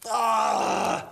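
ordinary delivery

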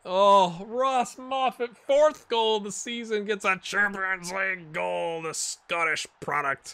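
scoring a Champions League goal, hyped announcer call; goal commentary. Acted.

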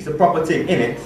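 "proper thing, innit" in a put-on British accent; attempted British accent. Learner tries British slang on friends, attempted British accent. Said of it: "a little bit of the British accent".

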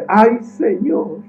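very slow, high, loud wail; wailing lament. Acted. Preacher acting the frightened servant's wailing lament. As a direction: drag out a hammed-up wail. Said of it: "acostumbrado a decir".

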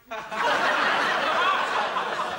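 a loud hearty cackle at his own boast; hearty laugh. Real, not acted. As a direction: burst out laughing at your own tall tale.

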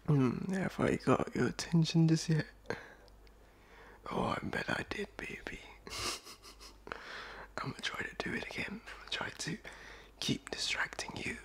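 teasing seductive whisper; ASMR voice. Acted. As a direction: purr it out playfully. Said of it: "my really not A S M R voice".

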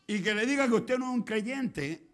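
loud, slow, emphatic preaching; drawn-out loud pulpit delivery; full-force preaching. Real, not acted. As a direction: proclaim it to the congregation with force.

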